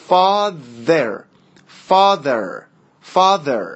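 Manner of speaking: "Father" drawn out with a buzzed th; tongue near the tip of the teeth for th in "Father"; voiced th drill. Shown as a demonstration. Teacher drilling learners on "Father", deliberate voiced th. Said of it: "این به نوک زبون نزدیکتر هستش".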